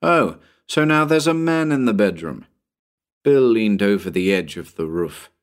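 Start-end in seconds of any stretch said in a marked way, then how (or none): 0.0-2.5 higher, lightly sceptical; mock disbelief. Bill challenges Tommy's story, lightly mocking disbelief. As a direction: humour a child's tall tale with doubt. Bill doubts the boy's tale.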